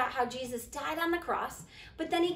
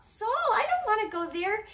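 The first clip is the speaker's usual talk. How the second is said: higher-pitched, anxious protest; worried Ananias; 7 semitones higher than usual. Acted. Ananias objects to visiting Saul, worried and high. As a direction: balk at it in a raised, worried voice.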